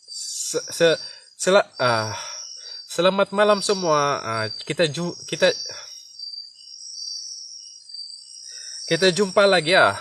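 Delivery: stuck on se before selamat; stammering through the goodbye; real stammer. Real, not acted. The host stammers while signing off the live stream. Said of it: "Mau datang-datang sudah saya punya gagap nih".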